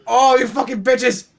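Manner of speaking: hammed-up gamer fury; angry outburst. Real, not acted. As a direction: rage at the thieves. Raging at banana thieves.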